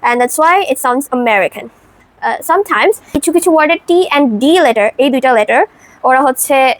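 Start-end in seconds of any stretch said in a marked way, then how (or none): 1.1-1.7 "American" leaning on the MER; stress on M. Shown as a demonstration. Explaining syllable pressure to learners, "American" stressed on MER. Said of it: "এম এর উপরে pressure put করে".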